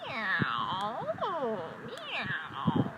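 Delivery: drawn-out, gliding meows; cat meow. A narrator's reading. Storyteller voices the cat singing along, long meows. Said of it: "And the cat sang".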